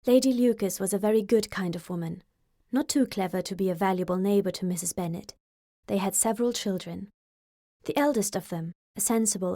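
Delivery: usual speaking voice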